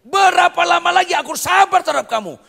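high, loud furious shout; great anger. Acted. Jesus shouting at the faithless crowd, furious loud outcry. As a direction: bellow it in anger. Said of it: "Itu marah besar".

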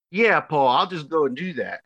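mock-agreeing with a sarcastic lift. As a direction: scoff at the idea, lightly exaggerated. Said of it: "kinda sarcastically".

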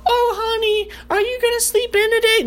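very high, sugary mock coo; fake-gentle wake-up; sweet falsetto honey. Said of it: "She ain't gonna sit there and go".

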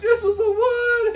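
imitating his own over-the-top delight at the car; mock elated. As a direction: gush like you found your dream car. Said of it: "And I was like".

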